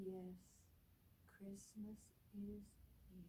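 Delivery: soft unvoiced whisper of the refrain. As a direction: breathe it like a secret.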